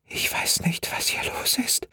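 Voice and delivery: high, breathless whispered fear; frightened whisper. A narrator's reading. Terrified girl whispering to the dispatcher. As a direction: hide and whisper the words.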